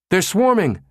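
sudden high shout of alarm; yelled. A narrator's reading. Technician Soshi yelling to the room about swarming hackers, alarmed. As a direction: yell out the warning. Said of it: "Soshi yelled".

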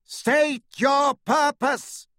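slow, high, metallic gate voice; clanging echoing voice. A narrator's reading. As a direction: boom it out like an iron face. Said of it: "spoke in a clanging, echoing voice".

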